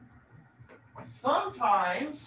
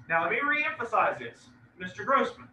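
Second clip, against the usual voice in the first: ordinary talk, nothing marked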